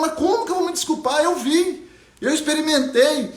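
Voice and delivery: put-on a girl who saw colour, insisting; young Ariana insisting.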